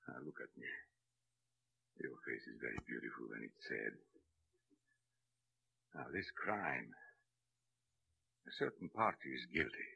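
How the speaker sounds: low, soft, spaced-out phrases; grave, hushed counsel. Acted. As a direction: reason with June quietly and gravely. Gus gravely counsels June.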